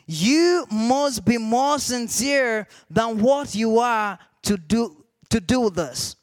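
very slow, measured pronouncement; commanding voice. Retold or imitated. The voice returning to instruct him, slow weighty command. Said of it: "the voice will come again and say".

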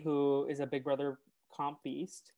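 ordinary delivery

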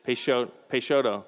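"Peixoto" corrected from "Peixeto", said as pay-SHO-to; self-correcting pronunciation. Shown as a demonstration. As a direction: fix the name mid-word to "Peixoto". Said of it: "I think that's how you say it".

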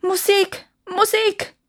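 high-pitched, desperate plea; pleading. A narrator's reading. Dying Emperor begs for music, pleading. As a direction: beg in a thin high cry. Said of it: "flehte der Kaiser".

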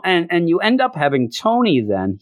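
ordinary delivery

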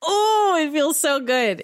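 high, loud burst of joy; real thrilled gush; gushing delight. Real, not acted. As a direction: swoon over the greeting. Gushing over Rex's warm welcome.

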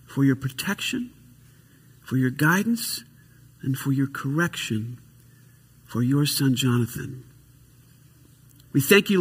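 prayer. Real, not acted. Prays for Jonathan.

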